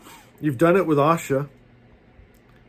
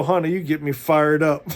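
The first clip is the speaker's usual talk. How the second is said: ordinary talk, nothing marked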